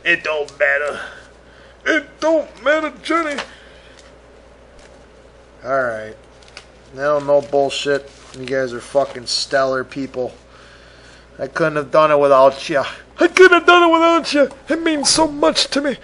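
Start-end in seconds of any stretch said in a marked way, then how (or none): 1.9-3.4 high, whiny mock lament; mock whine. Host to Johnny about nobody listening, mock whine. Repeats line to Johnny mockingly. 13.2-16.0 high, hammed-up choked thanks; mock tearful thanks. Host thanking listeners, hammed-up tearful gush.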